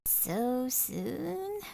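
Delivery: lightly voiced old grandmother. Abuela asks her granddaughter gently, in a light grandmother voice. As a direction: ask gently, as a granny.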